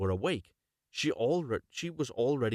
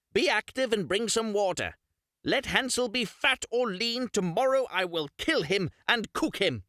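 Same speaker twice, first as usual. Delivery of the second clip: much higher, louder witch call; witch crying out; 11 semitones higher, 4 dB louder than usual. Acted. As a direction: snap the girl to attention. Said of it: "she cried to the girl".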